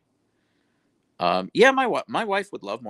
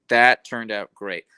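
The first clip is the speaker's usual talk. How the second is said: slow, louder, leaning on every word; emphatic; 8 dB louder, slower than usual. Real, not acted. Baker telling chat her creme diplomat was a hit, slow and emphatic. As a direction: savour it, she's proud of this.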